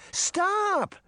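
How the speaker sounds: put-on very high girl's cry; high-pitched urgent plea; Daphne. A narrator's reading. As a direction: cry out desperately as a little girl. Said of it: "Daphne cried".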